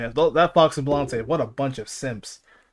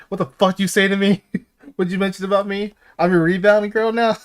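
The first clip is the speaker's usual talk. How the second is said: higher, louder hammed-up scolding; mock angry yell; 6 semitones higher, 6 dB louder than usual. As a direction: play the offended girl, half laughing. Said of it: "I'm gonna hear like".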